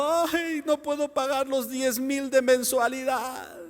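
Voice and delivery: high-pitched, wailing complaint; hammed-up weepy lament; mock crying. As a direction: imitate a tearful debtor wailing. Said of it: "ya después estás llorando".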